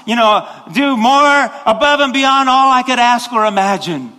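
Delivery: loud, high, fervent cry to God; intense passionate prayer. Shown as a demonstration. Preacher shows the congregation a passionate prayer, loud and high. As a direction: pour it all out, crying to heaven. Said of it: "when we get intense and passionate, we go".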